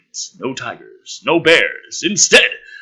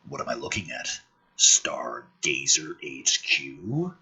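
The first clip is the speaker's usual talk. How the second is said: low voice; 11 semitones lower, 6 dB quieter, slower than usual. Acted.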